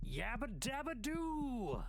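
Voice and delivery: very high, slow stretched cartoon caveman cry; Fred Flintstone yell. Gamer shouts the cartoon catchphrase, high and slow. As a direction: belt the catchphrase high and drawn out. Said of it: "Hey, Fred!".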